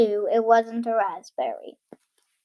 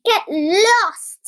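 higher, slightly drawn-out telling-off; cross shout; 6 semitones higher, 4 dB louder than usual. A narrator's reading. Cheeky tailor to the flies round his jam, cross shout. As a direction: shoo them off crossly, voice raised. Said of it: "he spluttered through a mouthful".

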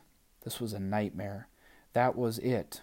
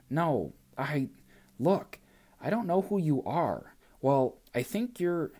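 flustered stammer; 6 semitones higher than usual. Acted.

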